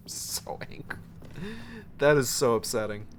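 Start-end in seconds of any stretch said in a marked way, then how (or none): 0.1-1.0 whispered, much softer; real seething whisper; angry whisper. Real, not acted. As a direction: breathe it out, still fuming.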